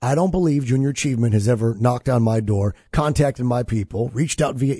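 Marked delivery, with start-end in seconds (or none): none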